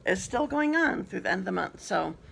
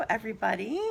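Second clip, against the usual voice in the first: ordinary delivery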